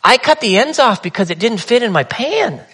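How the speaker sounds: grandma kindly explaining the pan; gentle grandma. Retold or imitated. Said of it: "She goes".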